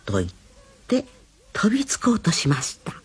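ordinary delivery

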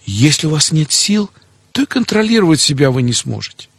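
ordinary talk, nothing marked

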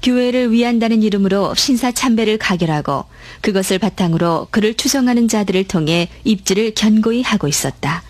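ordinary delivery

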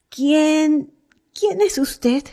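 high, timid, halting question; stammering. A narrator's reading. Sick woman startled by a stranger in her room, stammering. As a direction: falter on the first word, voice lifted high. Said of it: "dijo balbuceando".

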